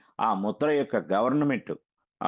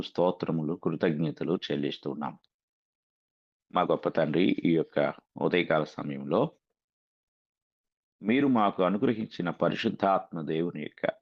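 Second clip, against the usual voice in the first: lower-pitched, measured prayer with pauses between phrases; closing prayer; 5 semitones lower than usual. Real, not acted. Preacher thanking the heavenly Father for the group, low measured prayer. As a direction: pray in short, reverent phrases, pitch settled low.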